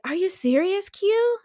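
high, loud, rising amazement; delighted disbelief. Retold or imitated. Dad hearing she's free on New Year's, surprised. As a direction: squeal it like great news. Dad thrilled by babysitter offer.

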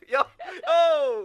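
loud high laughing whoop; hyped greeting yell; excited shout. Real, not acted. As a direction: cheer him in with a whoop. Cheering the guest's arrival.

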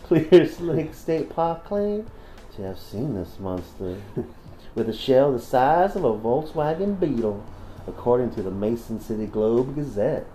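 a light mock Southern reading, slow in places; put-on southern reading. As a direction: read the news item like a hammed-up Southern gent.

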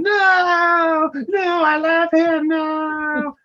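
high, drawn-out mock wail; mock screaming. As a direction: scream it like a melodramatic heroine. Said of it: "And Tammy's screaming".

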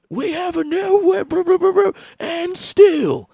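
squeaky high mocking voice of Dylan. Mocking Dylan's excuse in a squeaky high voice. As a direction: pitch way up to mock the friend.

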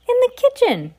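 very high squeaky little mouse voice; boy mouse voice. A narrator's reading. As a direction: squeak it out eagerly like a tiny mouse.

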